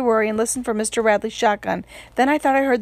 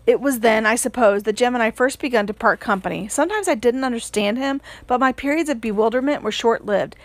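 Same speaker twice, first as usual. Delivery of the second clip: ordinary delivery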